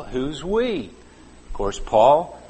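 normal talking voice

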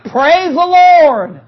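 loud, slow "Praise the Lord"; praise shout. Real, not acted. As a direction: call it out slowly with full force. Preacher exclaiming praise.

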